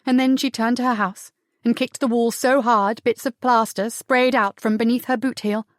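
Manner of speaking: plain reading voice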